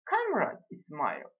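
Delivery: slow lady's order, rising very high; drawn-out, high-pitched command; imperious lady. A narrator's reading. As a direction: order your companion, drawing it out and climbing high. Acted lady orders her companion.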